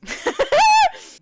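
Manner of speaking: very high burst of laughter; shrieking laugh. Real, not acted. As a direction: squeal with laughter. Laughing at the story.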